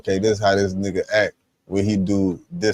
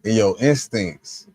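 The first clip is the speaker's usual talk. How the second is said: normal talking voice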